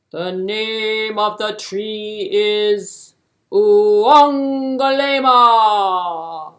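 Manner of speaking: slow, loud, regal king's voice; booming king. Acted. King grandly telling hare the tree's name, loud and slow.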